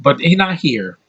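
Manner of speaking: usual speaking voice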